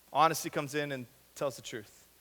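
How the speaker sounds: normal talking voice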